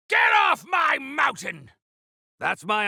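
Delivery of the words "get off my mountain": loud furious yell at intruders; angry shout. Acted. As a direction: drive them off your mountain. Shouting at invaders.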